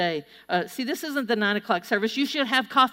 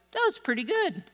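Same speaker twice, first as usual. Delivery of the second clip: mild approval; 7 semitones higher than usual. Acted. Mimicking passive churchgoers watching others.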